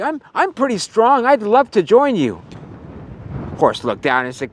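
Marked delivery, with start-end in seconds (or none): 0.0-2.4 higher, lightly voiced puppy bragging; eager little puppy. Puppy tells the horses he's strong, higher and eager. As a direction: puff up like a small pup.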